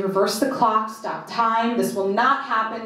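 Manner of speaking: usual speaking voice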